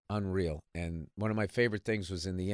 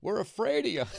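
audience member; 11 semitones higher, 3 dB louder than usual. Retold or imitated. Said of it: "This guy in the back goes".

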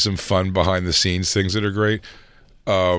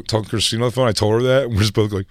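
ordinary talk

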